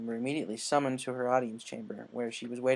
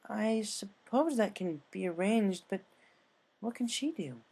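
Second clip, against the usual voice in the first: higher, slow puzzled pony voice; higher voiced doubtful unicorn; doubtful Twilight; 8 semitones higher, slower than usual. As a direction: question it slowly, unsure.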